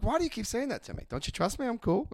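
high, soft, defensive protest; a rattled liar insisting he's cool; nervous defensiveness. Retold or imitated. Nervous defensive self-quote.

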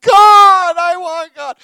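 very loud, high hammed-up bawl; sob-shout it, comically overdone; mock crying wail. Crying man answers flight attendant, hammed-up wail. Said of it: "And I'm crying".

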